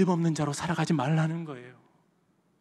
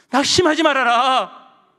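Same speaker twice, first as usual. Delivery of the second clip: loud, slow, raised command; 9 semitones higher, 11 dB louder, slower than usual. Acted. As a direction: cry out the encouragement with force. Lord's encouragement, cried out. (크고 느리게 외치는 격려)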